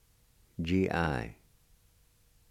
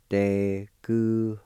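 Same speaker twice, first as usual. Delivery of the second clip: "Deguhohla" said slowly and carefully, each syllable clear; slow demo pronunciation; slower than usual. Shown as a demonstration. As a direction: model "Deguhohla" slowly for the class. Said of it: "And it's pronounced like this".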